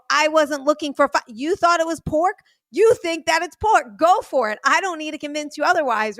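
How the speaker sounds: fed up, refusing to argue the point; retold exasperated comeback. Retold argument with friend over tacos.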